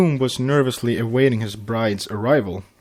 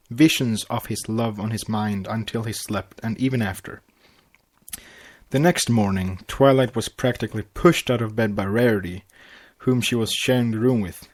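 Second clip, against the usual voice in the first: ordinary delivery, nothing marked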